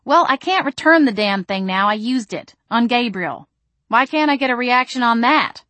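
indignant protest; exasperated. Acted. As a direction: argue back, fed up with the questions. Acted quarrel over the stun gun.

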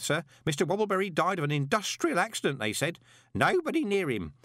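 high-pitched gossipy underling; lively, high character voice; chatty old postman Grote. Acted. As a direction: relay grim gossip with relish, pitched well up.

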